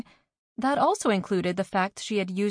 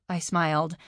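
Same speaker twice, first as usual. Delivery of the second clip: plain reading voice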